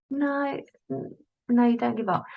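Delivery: high, soft little voice; little inner voice. Acted. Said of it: "you can hear that little voice".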